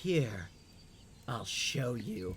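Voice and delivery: slow, deliberate threat; menacing queen. Acted. Queen threatening a thief in her palace, slow menace. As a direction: draw each phrase out with cold contempt. Game master continues the angry queen.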